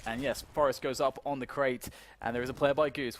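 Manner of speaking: ordinary talk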